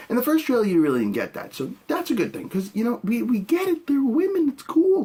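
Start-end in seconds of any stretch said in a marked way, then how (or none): 3.4-5.0 exasperated. Real, not acted. Mocking the obvious.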